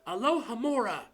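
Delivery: plain reading voice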